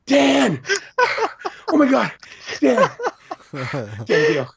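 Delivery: put-on high-pitched, squealing kid fan yelling a name; screaming little boy. Little fan screaming at his hero Dan, high and squealing. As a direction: shriek like a starstruck little boy. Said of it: "this little boy ... screaming at the top of his lungs".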